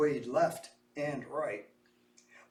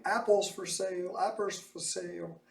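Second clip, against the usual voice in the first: street seller call; 6 semitones higher, 3 dB quieter than usual. A narrator's reading. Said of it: "women shouting".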